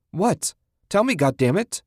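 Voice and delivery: high-pitched, exasperated insistence; urgent and angry; frantic demand. A narrator's reading. Susan frantically demands an answer.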